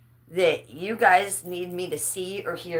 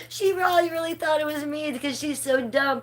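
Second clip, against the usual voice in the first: amused mocking; 8 semitones higher than usual. Real, not acted. Still laughing, mocking.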